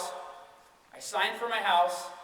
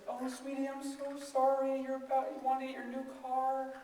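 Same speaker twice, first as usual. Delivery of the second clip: imitated sympathy; 5 semitones higher, 9 dB quieter than usual. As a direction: coo softly, imitating a consoling girlfriend. Girlfriend consoling him.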